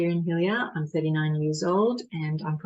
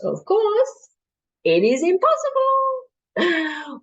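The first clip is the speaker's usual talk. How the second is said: much higher pitch, "impossible" slowly drawn out; emphatic exclamation; 13 semitones higher, 4 dB louder, slower than usual. Acted. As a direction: hit "impossible" high and stretch it slowly. Dramatic climax of performed monologue.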